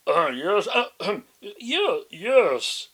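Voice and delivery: wolf faking a high, loud granny voice, hesitant; disguised voice. A narrator's reading. Wolf pretending to be grandma, clumsy disguised voice. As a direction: clear throat and fake an old lady badly. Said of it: "trying to disguise his voice".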